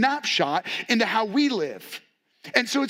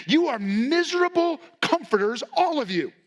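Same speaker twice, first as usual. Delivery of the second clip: rebuke; 7 semitones higher than usual. Retold or imitated. Job rebuking his friends.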